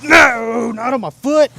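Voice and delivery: sudden yelp of protest; startled outcry. Real, not acted. Dog steps on his foot.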